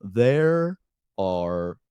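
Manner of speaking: low, drawn-out turtle speech; lightly voiced slow turtle. Turtle player announcing kobolds, slow low turtle drawl. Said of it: "he would talk like this".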